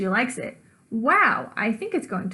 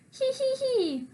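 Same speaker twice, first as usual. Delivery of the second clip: high, quiet sneaky giggle; gleeful giggle; 14 semitones higher, 3 dB quieter, slower than usual. Acted. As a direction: giggle with wicked delight. Gleeful plotting child narrator.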